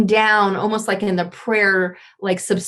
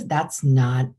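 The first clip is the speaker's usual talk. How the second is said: usual speaking voice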